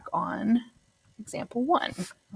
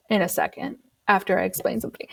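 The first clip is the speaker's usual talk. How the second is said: whisper; 4 dB quieter, faster than usual. Real, not acted. Aside to class.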